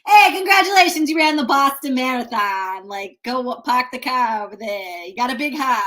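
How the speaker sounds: hammed-up Boston accent, high and loud; mock Boston accent cheering a marathon runner. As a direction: play a cheery Boston local shouting congrats. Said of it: "and all of their accents".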